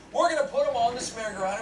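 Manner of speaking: ordinary delivery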